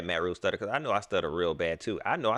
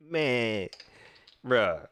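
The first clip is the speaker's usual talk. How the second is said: ordinary talk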